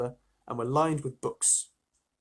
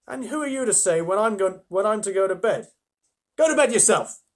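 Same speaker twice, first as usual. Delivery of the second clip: bad-tempered retort; 6 semitones higher, 4 dB louder than usual. Edmund bad-tempered argument.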